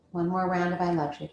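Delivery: normal talking voice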